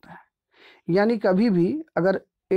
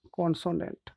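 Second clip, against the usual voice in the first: Consonant repeated much softer; 8 dB quieter than usual. Shown as a demonstration. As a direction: drop to a soft echo of consonant. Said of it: "consonant से शुरू होता है".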